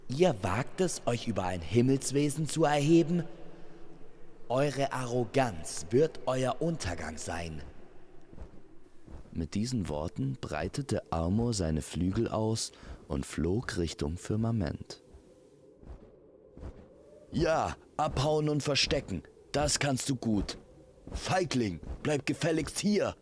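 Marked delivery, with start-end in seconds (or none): 0.1-7.6 raised, outraged celestial being; haughty, offended warning with punched words; indignant threat. Acted. Offended heavenly being threatens his challenger. 17.3-23.2 high, taunting call at someone fleeing; raised voice, indignant and mocking; angry calling after. Calling angrily after Amor flying off.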